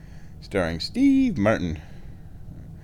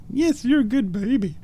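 high-pitched cooing praise; 13 semitones higher than usual. Real, not acted. Vlogger praising cat for jumping up, high baby talk.

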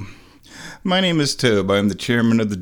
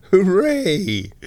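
excited cheer; 7 semitones higher than usual. Pretend. Mock cheer over painkillers.

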